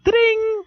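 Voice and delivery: high ringing "ding" by voice; imitated door chime. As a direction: sing out a shop bell ding.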